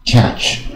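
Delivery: "catch" with open "a", light final "ch"; careful one-syllable "catch"; curled-tongue model. Shown as a demonstration. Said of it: "也是很卷舌啊".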